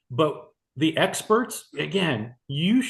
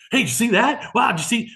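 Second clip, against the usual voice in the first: excited overtalking, higher and louder; eager interrupting; 9 semitones higher, 4 dB louder than usual. Pretend. Consultant talking over a video shown to a prospect, excited. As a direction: butt in loudly with eager excitement.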